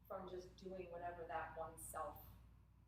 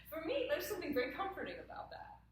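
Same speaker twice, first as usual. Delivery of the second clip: amused; 10 semitones higher, 7 dB louder than usual. Real, not acted. Laughs while saying death is comforting.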